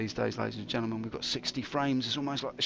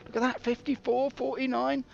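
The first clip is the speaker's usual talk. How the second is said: excited; 10 semitones higher than usual. Real, not acted. Calling out frame counts.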